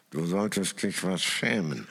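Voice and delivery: mild growl of a reproach; a lightly voiced grumble of reproach. Older friend scolding after the moped fall, mild growl. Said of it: "knurrte dein Freund".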